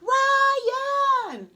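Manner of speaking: high, loud joyful call; calling across to his cousin; shouting a name. Acted. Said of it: "McKinley shouted".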